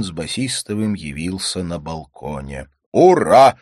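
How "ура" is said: loud, high cheer; exclaiming. A narrator's reading. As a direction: cheer it out joyfully. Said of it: "воскликнул он и обнял жену".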